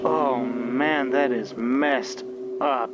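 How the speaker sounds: usual speaking voice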